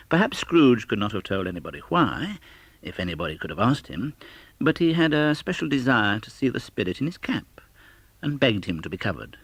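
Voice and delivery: plain reading voice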